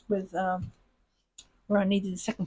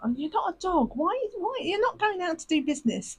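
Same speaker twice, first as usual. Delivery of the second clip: amused self-scolding; 9 semitones higher than usual. Retold or imitated. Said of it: "I'm just like".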